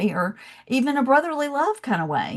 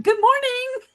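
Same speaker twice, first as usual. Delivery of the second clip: bright, much higher singsong good morning; cheery Southern greeting; slower than usual. Retold or imitated. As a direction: chirp it like a friendly Southern regular. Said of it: "every day I was like".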